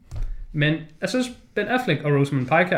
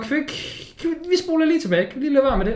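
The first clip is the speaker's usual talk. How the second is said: pleading retake; 11 semitones higher, much faster than usual. Retold or imitated. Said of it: "og så var sådan".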